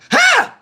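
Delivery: very high yelp of pain; sudden high holler, as if stung; acted holler. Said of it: "I holler".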